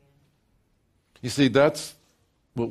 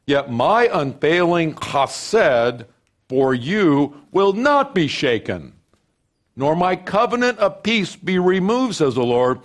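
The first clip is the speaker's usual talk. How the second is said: loud, high, ringing scripture proclamation; full-force preaching; 10 semitones higher, 8 dB louder than usual. Acted. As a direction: proclaim it to the whole congregation.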